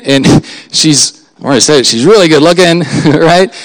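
ordinary delivery

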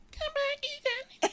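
very high, squeaky come back Ethan; shrill-pitched mocking version of the line; mock high squeal. Shown as a demonstration.

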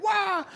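thin, high-pitched "wah"; softened imitation war whoop; mock battle cry. Preacher imitating Deborah charging by chariot, mock war cry.

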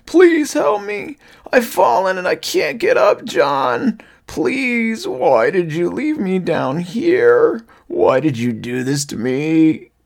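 loud, high wailing, drawn out; pleading. A narrator's reading. Fallen father-thing reproaches Johnny for leaving, pleading. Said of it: "the thing on the ground pleaded".

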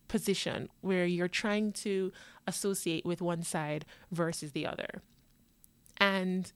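ordinary delivery

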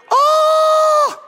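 very high, loud shouted "oh"; exultant whoop. Real, not acted. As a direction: cry out in overjoyed amazement. Climax of exultant preaching.

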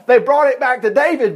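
usual speaking voice

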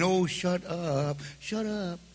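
slow, hushed mock "shut up"; a lightly voiced jeering crowd; mock heckle. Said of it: "Everybody goes".

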